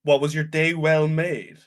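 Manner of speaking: loud, high, slow mock British accent; hammed-up British greeting, stretched out. Mocking a friend's muddled greeting, exaggerated British accent. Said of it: "in that accent".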